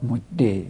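مده softly as "modde"; kasra ending. Shown as a demonstration. Teacher saying kasra is allowed, مده as "modde". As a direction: softly end مده on an "e" vowel. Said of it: "حرکت به کسره بدی".

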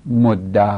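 مده with an open "a" ending, "modda"; fatha ending. Shown as a demonstration. Teacher showing students the fatha form, مده as "modda". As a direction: end مده on a long fatha "a".

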